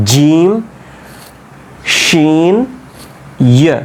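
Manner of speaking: জিম, শিন, ইয়া made behind the gum ridge; drawn-out জিম, শিন, ইয়া from just behind the ridge; articulation demo. Shown as a demonstration. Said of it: "ওই এবড়ো থেবড়ো জায়গারও পেছনের দিকে".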